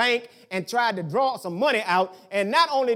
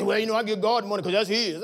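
imitating people. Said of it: "People say".

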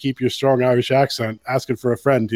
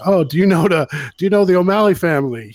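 eager American customer; 5 semitones higher, 3 dB louder than usual. Retold or imitated. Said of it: "she would say".

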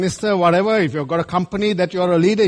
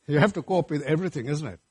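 ordinary talk

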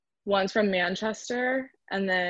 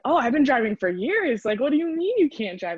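higher, louder incredulous reaction; mock astonishment, pitched up high; retold disbelief; 6 semitones higher, 4 dB louder than usual. Teammate reacting to friend who can't drive, retold disbelief.

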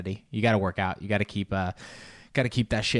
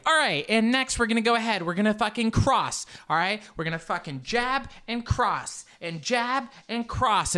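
online instructor; 11 semitones higher than usual. Retold or imitated. Imitating the workout video instructor calling out moves. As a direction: pump up the class like an on-screen trainer. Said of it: "she'll be like".

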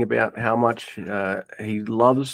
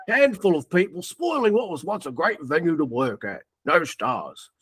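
lightly put-on voice of an angry reviewer, higher; mock-indignant review read a bit higher; reading a review in character; 7 semitones higher than usual. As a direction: read it as an outraged customer. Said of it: "All right, here we go".

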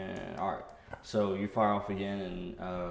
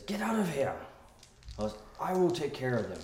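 half-yell it higher, as an angry character's order; yelling male Twi'lek; 4 semitones higher than usual. Acted. Male Twi'lek ordering a woman out, mock yelling. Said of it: "he yells at her".

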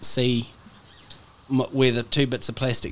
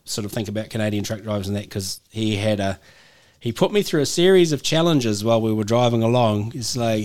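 normal talking voice

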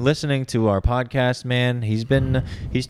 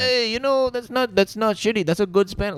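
put-on high, hammy Spanish accent; 10 semitones higher than usual. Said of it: "Let's hear your Spanish accent".

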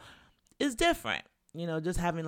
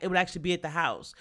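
usual speaking voice

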